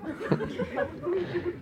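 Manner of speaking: quiet high laugh; a soft amused chuckle. Real, not acted. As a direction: laugh softly to yourself. Laughs after mock quote.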